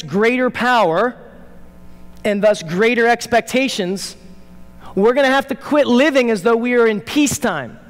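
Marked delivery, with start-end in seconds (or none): none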